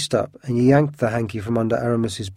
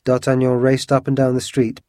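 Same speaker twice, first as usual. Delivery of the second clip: ordinary delivery, nothing marked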